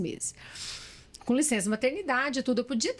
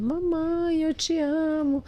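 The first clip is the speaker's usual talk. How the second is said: put-on higher, slow little girl's loving plea; 5 semitones higher, much slower than usual. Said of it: "A Alice passava cartinha". (voz de menininha, mais aguda, carinhosa e devagar)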